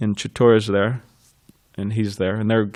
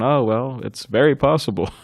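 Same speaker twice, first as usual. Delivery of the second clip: usual speaking voice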